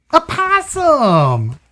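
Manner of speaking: slow, high possum; high drawn-out voice. Acted. PJ chooses the possum, slow and high.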